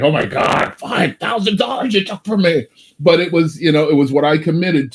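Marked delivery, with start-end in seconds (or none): 0.0-2.7 mock outrage. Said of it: "it was just like".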